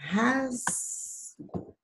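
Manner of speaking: "Rasgo" with "Ras" held long and high; ударный слог. Shown as a demonstration. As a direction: stretch the strong "Ras" in "Rasgo". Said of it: "da sílaba mais forte".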